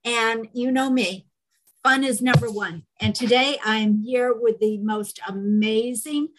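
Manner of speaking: ordinary delivery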